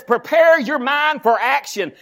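slow shouted verse; scripture quote. Acted. Pastor quoting the Bible to the church, slow shout. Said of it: "The Bible says".

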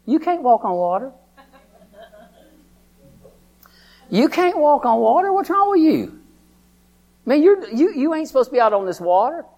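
high, loud mocking devil voice; taunting devil. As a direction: sneer it like the devil taunting him. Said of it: "the devil said".

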